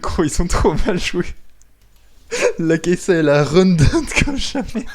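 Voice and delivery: loud, high, cracking up; laughing disbelief. Real, not acted. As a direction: shout it through laughter. Cracking up at bad play.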